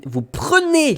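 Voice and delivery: "prenez" stretched out slowly; emphasis on PRENEZ. Retold or imitated. Colleague giving clearer directions, "prenez" drawn out. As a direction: lean long on the word "prenez". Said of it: "un mot supplémentaire".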